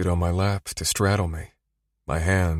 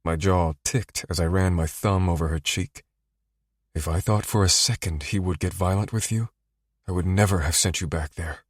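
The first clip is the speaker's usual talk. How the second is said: plain reading voice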